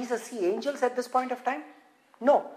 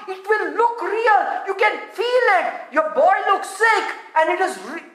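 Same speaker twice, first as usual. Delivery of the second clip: high, emphatic exhortation; forceful preaching; 8 semitones higher than usual. Real, not acted. Preacher warning congregation not to trust the soul, forceful. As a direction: drive each warning home in a raised pitch.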